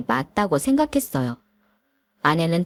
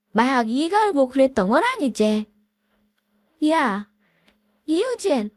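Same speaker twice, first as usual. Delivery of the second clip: higher voiced feuding old classmates; catty women arguing; 7 semitones higher than usual. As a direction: snipe and bicker like offended women. Women quarrel at reunion. (동창생 여자들의 날 선 말다툼)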